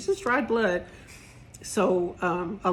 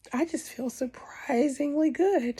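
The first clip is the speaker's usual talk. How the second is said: whispered; 7 semitones higher than usual. Real, not acted. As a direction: whisper it like a happy secret. Repeats feeling good after surgery.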